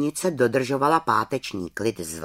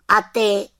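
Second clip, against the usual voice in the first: put-on high, louder child's question; Tomáš asking; 9 semitones higher, 6 dB louder than usual. Tomáš asks the witch over his cup, high voice. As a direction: ask up like a curious boy. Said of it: "zeptal se Tomáš".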